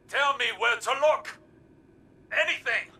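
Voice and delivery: high, loud burst of frustration; angry demand. Acted. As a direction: shout it as a desperate demand for answers. Heated confrontation, demanding answers.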